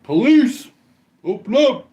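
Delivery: loud, high police bark at the door; cop shouting. Retold or imitated. Cop yelling at the van to open up, loud and high. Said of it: "you know what?".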